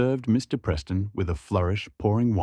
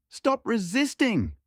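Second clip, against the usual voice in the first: much higher, slow yell; slower than usual. A narrator's reading. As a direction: bark the order as you twist. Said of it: "Beatrice yelled".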